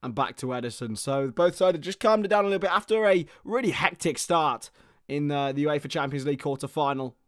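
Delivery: steady live match commentary; play-by-play. Acted. Commentator calling the match for viewers, play-by-play. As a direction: narrate each pass as it happens.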